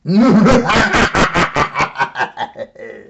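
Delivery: loud villainous cackle; big evil laugh. Acted. Other dinosaur laughing wickedly, loud and evil. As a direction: laugh like a gleeful monster. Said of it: "sounded like a big, evil laugh".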